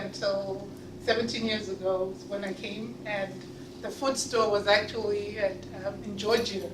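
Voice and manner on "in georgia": usual speaking voice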